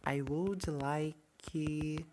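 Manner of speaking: "I would like" drawn out syllable by syllable, "would" stressed; slow pronunciation model; very slow demo. Shown as a demonstration. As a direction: spell it out for beginners, very slowly. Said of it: "Cuidado pra vocês não pronunciarem".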